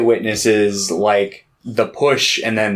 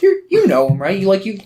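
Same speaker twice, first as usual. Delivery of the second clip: much higher, sing-song coaxing aunt voice; sugary scheming aunt; 9 semitones higher than usual. Retold or imitated. Aunt sweetly asking her nephew to send the repairman, high and coaxing. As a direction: coax the favour out sweetly, way up high.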